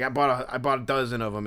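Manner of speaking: ordinary delivery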